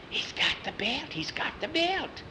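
high, hushed witch squeal; excited witch whisper. Acted. Amazed witches see the belt.

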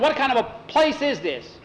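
high-pitched exasperated demand; indignant outburst. A narrator's reading. Tom protests to the steward about the place, indignant outburst. Complaint about missing things.

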